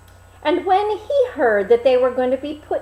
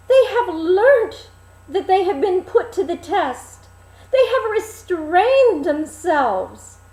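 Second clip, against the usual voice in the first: lion explaining; 9 semitones higher, 5 dB louder than usual. Acted. Lion answers the king.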